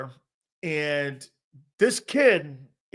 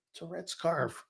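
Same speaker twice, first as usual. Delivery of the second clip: hushed to cousin; 10 dB quieter than usual. Retold or imitated. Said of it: "I looked at my cousin".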